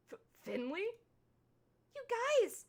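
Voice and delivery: put-on high, bright, excited girlish voice; bubbly Finley. Voices cheerful Finley character.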